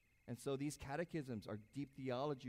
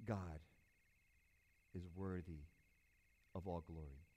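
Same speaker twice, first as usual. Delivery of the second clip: reverent praise; 8 semitones lower, 5 dB quieter than usual. Retold or imitated. Pastor voices a worshipper leaving church, softly reverent. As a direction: speak each word like a quiet prayer.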